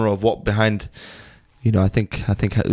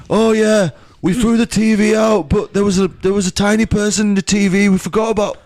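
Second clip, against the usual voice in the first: [name] impression; 10 semitones higher than usual. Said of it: "I'm trying to do [name]".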